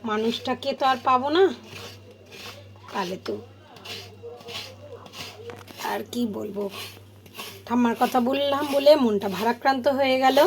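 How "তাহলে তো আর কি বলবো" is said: sad whisper. Real, not acted. Sad memory of grandmother.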